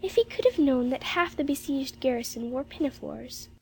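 wondering, faraway musing; Jane, dreamy. Acted. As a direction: drift through the thought in a little girl's voice. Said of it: "said Jane dreamily".

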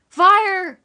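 loud, high cry of alarm; alarm call. A narrator's reading. Oswald to a man he runs into, loud alarm. Boy tells man of the fire.

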